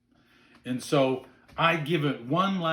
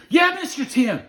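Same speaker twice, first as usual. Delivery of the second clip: high, loud mock child's reply; eager little boy; 12 semitones higher, 8 dB louder than usual. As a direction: pipe up like an excited kid. Retold child eagerly answering guide.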